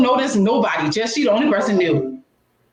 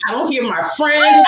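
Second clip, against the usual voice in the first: ordinary talk, nothing marked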